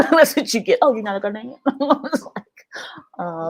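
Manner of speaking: usual speaking voice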